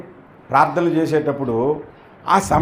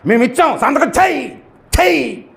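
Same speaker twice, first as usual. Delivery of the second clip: high, bossy order to sign; mock demanding; 7 semitones higher than usual. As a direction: demand it rudely from the minister. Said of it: "అంటావా?".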